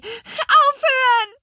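very high, drawn-out scream; loud, panicked squeal; shrieking. A narrator's reading. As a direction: screech for it to stop. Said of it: "kreischte sie".

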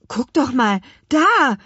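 high, loud excited shout; sudden call. A narrator's reading. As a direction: call out and point something out. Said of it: "rief Louis plötzlich". (plötzlich aufgeregt gerufen)

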